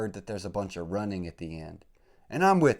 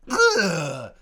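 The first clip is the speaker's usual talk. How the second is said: loud, high grunt of exertion; effort grunt; 9 semitones higher, 12 dB louder than usual. Pretend. Acting out a pull-up jumper on the podcast, loud grunt. Imitating a move on the court.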